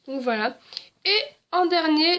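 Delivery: ordinary talk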